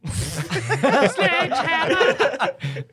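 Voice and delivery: high-pitched cackle; high burst of laughter. Real, not acted. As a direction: laugh hard at the joke. Reacting to joke.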